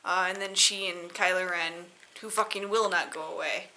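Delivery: ordinary delivery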